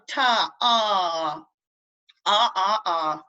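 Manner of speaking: "ta", then drawn-out "ah" sounds; long AH fada. Shown as a demonstration. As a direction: hold each "ah" open and long. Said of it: "say an ah fada properly".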